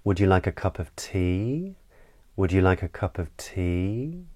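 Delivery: "tea" glides up as an invitation; rising question intonation. Shown as a demonstration. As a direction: lift the pitch on "tea", inviting an answer. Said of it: "it comes up in the voice".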